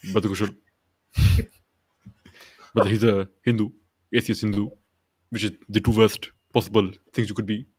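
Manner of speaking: low, halting preacher delivery; measured mock-sermon with pauses between phrases; Zakir Naik. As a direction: address a brother in a low, deliberate preacher's voice. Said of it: "he speaks like this".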